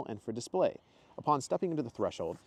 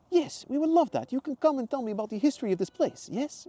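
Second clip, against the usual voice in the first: put-on Mateo's accent; 6 dB louder than usual. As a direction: gush over her in a put-on accent. Mateo voiced.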